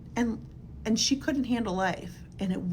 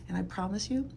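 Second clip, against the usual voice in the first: usual speaking voice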